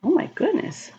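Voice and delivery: plain reading voice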